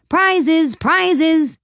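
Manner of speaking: high, loud clamouring crowd; calling out. A narrator's reading. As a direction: shout it over each other. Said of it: "calling out in a confused way".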